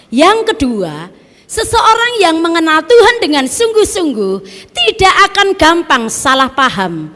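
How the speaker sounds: high, loud preacher's proclamation; preaching at full force. Acted. Preacher to the church, proclaiming loud and high. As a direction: ring it out to the congregation.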